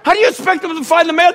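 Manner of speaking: high, loud mock scolding; imitated mom losing all sympathy; exasperated mother. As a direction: scold a daughter in shrieked disbelief. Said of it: "Mama goes from sympathizing to like".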